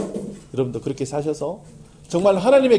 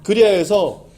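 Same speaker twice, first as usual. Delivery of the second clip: earnest, swelling pastor's prayer; closing prayer; 5 dB louder than usual. Acted. Pastor leading the congregation in closing prayer, fervent. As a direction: pray it out, rising in fervor.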